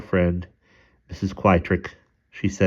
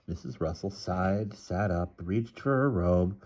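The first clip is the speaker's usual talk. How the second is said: usual speaking voice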